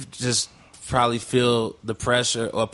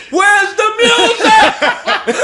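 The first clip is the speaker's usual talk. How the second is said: drawn-out, high-pitched full scream; huge comic retold holler; 9 dB louder, much slower than usual. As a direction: scream it slowly down the street after a car. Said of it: "screaming at his car".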